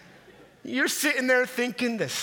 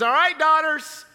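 lightly voiced dad, loud and higher; announcing dad; 7 semitones higher, 6 dB louder than usual. Dad gathering his daughters for presents, hearty announcing. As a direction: call the daughters together.

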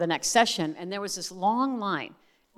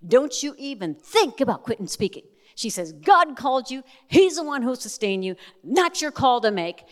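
higher and louder, a bold woman laying down the law; sassy angel scolding; 6 semitones higher, 5 dB louder than usual. Retold or imitated. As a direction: scold her like a sassy angel who won't take no. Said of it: "she leans on the table and she goes".

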